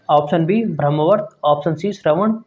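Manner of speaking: normal talking voice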